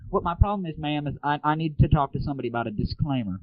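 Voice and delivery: ordinary delivery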